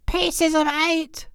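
high, slow ghostly parrot; unearthly voice. Acted. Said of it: "says the ghost of long ago".

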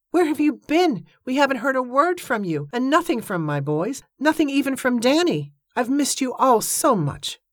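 high, pleading run, slowing at the end; heartfelt outpouring. A narrator's reading. Evangeline tells Iris how much she missed her, high heartfelt plea. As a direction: pour it out with pitch lifted throughout.

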